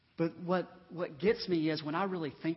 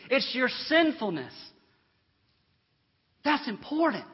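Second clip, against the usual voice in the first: higher, louder pulpit warning; emphatic preaching; 6 semitones higher, 7 dB louder than usual. Acted. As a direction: drive the contrast home in weighty, measured phrases. Preaches at full force.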